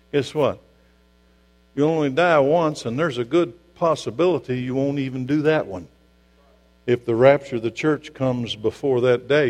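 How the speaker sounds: ordinary talk, nothing marked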